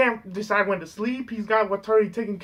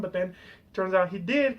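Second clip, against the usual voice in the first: normal talking voice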